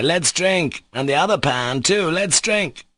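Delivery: high, boisterous invitation to drink; a lightly drunk host urging a toast; eager toast. A narrator's reading. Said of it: "cried Mitya".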